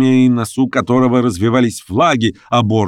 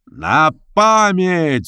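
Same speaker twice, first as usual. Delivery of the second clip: higher, drawn-out call; exclamation; 8 semitones higher, much slower than usual. A narrator's reading. Red coat shouts to Daisy, throwing a keepsake. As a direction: exclaim it as you throw the streamer. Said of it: "вскричал красный камзол".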